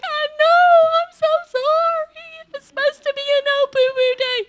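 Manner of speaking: very high, loud sobbing apology; crying. Retold or imitated. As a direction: break down into tears while apologizing. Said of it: "And I start crying".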